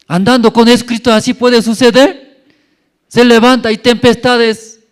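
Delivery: high, loud preacher's cry; preaching at full force. Acted. Preacher crying out to the congregation, loud and high. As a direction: proclaim it high and loud to the church.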